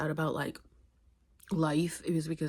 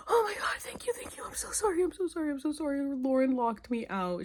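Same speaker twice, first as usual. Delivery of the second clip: high, gasping, tearful-sounding gush; retold frantic apology; 9 semitones higher than usual. Thanking the roommate who opened the door, acted frantic relief. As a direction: act out breathless thanks tumbling into apology.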